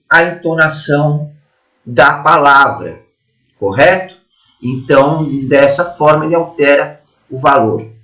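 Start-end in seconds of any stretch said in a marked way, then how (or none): none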